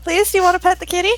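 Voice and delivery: high, quick pleading; coaxing a crying child, high and fast. Acted. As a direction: beg them to pet the kitty. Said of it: "It's like".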